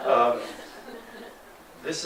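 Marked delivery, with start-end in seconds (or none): 0.1-0.4 laughing. Real, not acted. Laughter fading.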